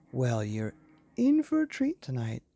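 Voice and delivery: ordinary delivery, nothing marked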